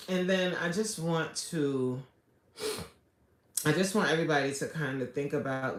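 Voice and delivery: usual speaking voice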